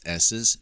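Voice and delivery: "S's" with a long, loud hiss at the start; "S's" drawn out as a sharp hiss; exaggerated sibilance. Shown as a demonstration. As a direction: stretch out the hissing s in "S's". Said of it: "picking up a little on the higher end".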